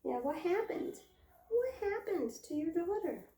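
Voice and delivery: high singsong echo; teasing pet talk. Pretend. Owner asking mother goat about her daughter, teasing singsong. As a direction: echo the question high, playfully.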